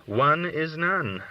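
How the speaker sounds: slow, weighty, each word set apart; slow proverb. A narrator's reading. Narrator drops an old proverb on the listener, slow and weighty. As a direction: lay down the old saying slowly. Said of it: "as the saying goes".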